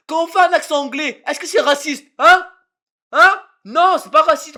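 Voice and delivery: very loud, high-pitched protest; indignant shouting. Real, not acted. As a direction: shout down an accusation, outraged. Argues with viewers about accents.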